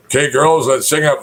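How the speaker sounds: rally the singers, lifting the voice a little; Pindar. Acted. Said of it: "This Pindar conducting the chorus, getting them ready".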